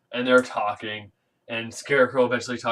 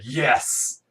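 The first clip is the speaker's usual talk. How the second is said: gloating; 6 dB louder, much slower than usual. Retold or imitated. Bane gloating at discovery.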